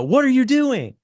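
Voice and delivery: high loud outburst on WHAT; exclaimed question. Shown as a demonstration. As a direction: shout it out in alarm, pitch up high. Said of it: "I want it to be a".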